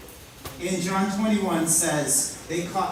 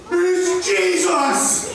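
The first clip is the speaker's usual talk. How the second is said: excited; 8 semitones higher, much slower than usual. Retold or imitated. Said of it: "and he's like".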